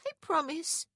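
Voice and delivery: put-on very high child's voice; little girl's promise. A narrator's reading. Little girl answering her uncle.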